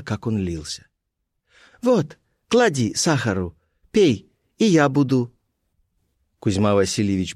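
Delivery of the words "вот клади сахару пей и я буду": put-on high, measured girlish instructions; Colibri's voice. A narrator's reading. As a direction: offer it in a high character voice, phrase by phrase. Colibri offers the coffee.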